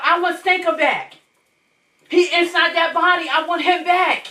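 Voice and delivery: retold insistent plea. Mother retelling how she demanded her son back, pleading.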